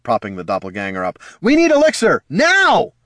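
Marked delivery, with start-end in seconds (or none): 1.4-2.9 high, loud demand; urgent yell. A narrator's reading. Bard calling for elixir for the wounded, urgent yell. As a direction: shout for help, urgently. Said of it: "Heraldon yelled".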